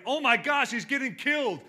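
high, loud alarmed cry; panicked trainer. Retold or imitated. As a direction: shout it like a panicking cornerman. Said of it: "one of Rocky's trainers like".

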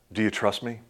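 soft asking voice; gentle, hushed question. Retold or imitated. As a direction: let it drop to a soft plea. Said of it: "he says".

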